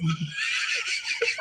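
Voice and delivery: high squealing laugh; burst of laughter. Real, not acted. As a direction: explode into a shrieking laugh. Laughs at own accent slip.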